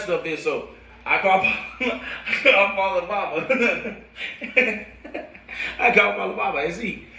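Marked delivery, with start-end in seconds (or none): 2.3-7.1 laughing. Real, not acted. Laughing retelling the call.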